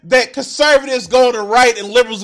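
angry rant. Real, not acted. Streamer ranting at video guest.

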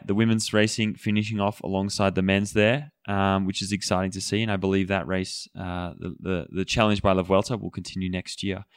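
ordinary delivery

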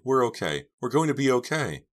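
high, loud relieved exclamation; jubilant, thankful outburst; grateful relief. A narrator's reading. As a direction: reassure yourself, voice lifting with relief. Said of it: "Dina thought gratefully".

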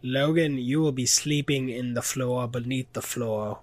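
badly faked Australian accent; mock Australian voice for a comic impression; horrible Australian accent. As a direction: put on a hammy, poor Australian accent. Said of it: "I'm doing a horrible Australian accent".

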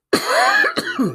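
sharp cough to clear the throat; throaty cough. Real, not acted. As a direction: cough hard, clearing a frog. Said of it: "Got a frog in my throat".

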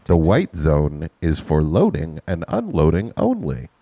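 slow, measured PA announcement; airport announcement. Shown as a demonstration. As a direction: read it like the terminal loudspeaker. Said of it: "Let me, let me do something".

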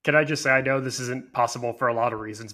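normal talking voice

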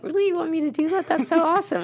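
thrilled someone picked you; delighted disbelief. Retold or imitated. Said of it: "And I was like".

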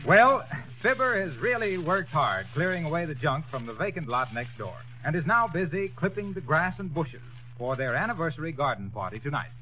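ordinary talk

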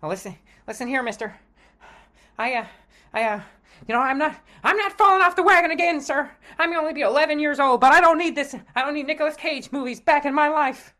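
put-on high, eager gee-whiz boy; wholesome 1950s kid. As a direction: innocent golly-gee schoolboy voice. Kid resisting relapse, agitated.

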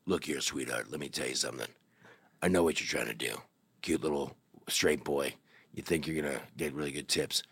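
huge gruff bartender. Retold or imitated. Huge bartender warning a straight boy off, low condescending voice. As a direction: talk down to the kid like a big bouncer. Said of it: "he's like".

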